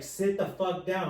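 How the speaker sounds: loud, drawn-out curt order; bitchy nurse. Retold or imitated. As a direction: imitate a nurse snapping at a patient. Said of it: "she's being a bitch".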